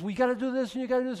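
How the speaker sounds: high, desperate demands fired at God; panicking and insisting it must happen now; freaked out. Retold or imitated. Believer telling Jesus what to do, high and panicked. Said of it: "and we're all freaked out".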